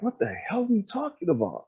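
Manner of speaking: high-pitched incredulous pushback; imitating an exasperated retort; retold exasperation. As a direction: argue back in mock exasperation, voice up. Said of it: "That just pissed me off".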